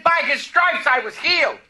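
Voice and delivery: loud, high declaration with conviction; declared loud. Retold or imitated. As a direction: proclaim it like slamming a fist on the desk. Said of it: "as clear as I'm just yelling it out to you".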